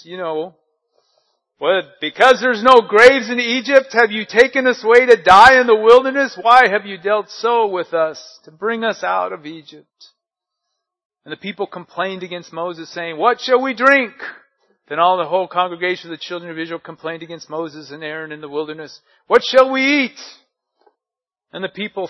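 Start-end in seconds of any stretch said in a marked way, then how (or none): 1.6-10.1 loud, high, indignant complaint; whining Israelites. Acted. Israelites complaining to Moses, loud high whine. 13.2-14.2 loud, high, indignant complaint; whining Israelites. Acted. Israelites complaining to Moses, loud high whine. 19.3-20.2 loud, high, indignant complaint; whining Israelites. Acted. Israelites complaining to Moses, loud high whine.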